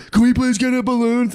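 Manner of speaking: high-pitched imitation of a begging child; pleading kid. Kids beg dad for a balloon, high pleading child voice.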